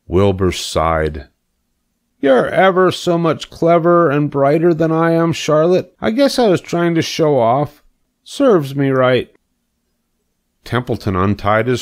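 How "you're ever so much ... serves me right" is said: higher piglet voice, owning his failure; a lightly voiced abashed pig; humbled young pig. Wilbur admitting to Charlotte he showed off, humbly.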